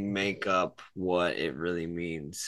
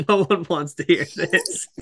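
laughing; 9 semitones higher, 9 dB louder than usual. Real, not acted. Laughing at the conversation.

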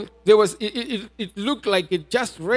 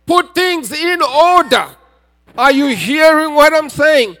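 louder, higher-pitched sermon delivery; forceful preaching; 3 semitones higher, 6 dB louder than usual. Real, not acted. Preacher urging congregation to put things in order, forceful preaching. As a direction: drive each point home to the congregation.

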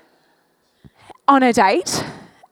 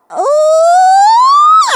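high, loud delighted squeal; a shrill little shout of thrilled surprise; excited squeal; 6 dB louder than usual. Retold or imitated. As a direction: squeal it out like a giddy girl in love. Excited squeal at hand-holding.

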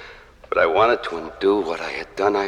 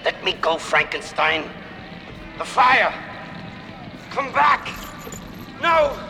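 desperate screaming; 4 dB louder than usual. Acted. Monster trapped in burning house.

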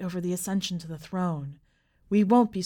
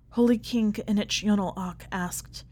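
ordinary delivery, nothing marked

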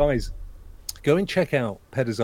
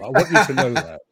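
ordinary talk, nothing marked

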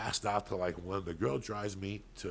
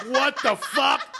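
high, loud, slow disbelieving yell with a laugh; mock shocked yell; 14 dB louder, much slower than usual. Retold shocked reaction, laughing.

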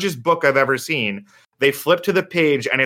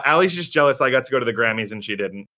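ordinary delivery

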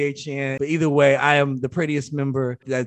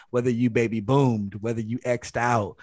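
usual speaking voice

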